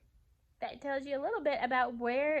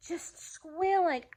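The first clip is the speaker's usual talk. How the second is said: mock squeal; 6 semitones higher, slower than usual. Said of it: "I was busy squealing".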